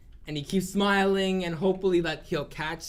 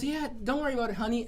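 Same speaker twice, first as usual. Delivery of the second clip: lightly voiced, higher and easy; reassuring voice; 6 semitones higher, faster than usual. To the half orc, a lightly voiced easy reassurance. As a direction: brush it off sweetly, a touch higher.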